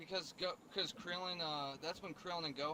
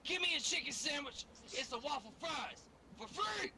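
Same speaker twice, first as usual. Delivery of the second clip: higher, yelled order in a put-on voice; demanding shout; 12 semitones higher than usual. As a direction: bark the order at the cashier. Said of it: "I was like".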